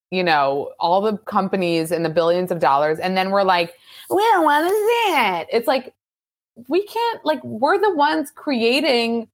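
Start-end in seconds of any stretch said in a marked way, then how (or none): 4.1-8.3 high-pitched mocking whine of the public; mock complaining. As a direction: squeal it like a petulant crowd.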